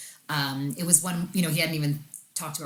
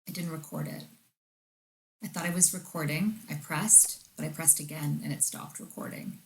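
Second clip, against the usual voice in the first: retold whisper; 6 dB quieter than usual. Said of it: "whispered in my ear".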